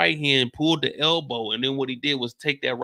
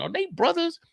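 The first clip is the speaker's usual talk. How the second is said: confused. Real, not acted. Confused bystander wondering aloud.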